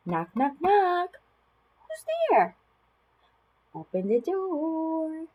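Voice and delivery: sing-song, exaggerated high swoops with stretched words; animated picture-book reading with big gasps; reading to children. Shown as a demonstration. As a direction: play it up for a toddler, full of wonder. Reads a lift-the-flap book to a child.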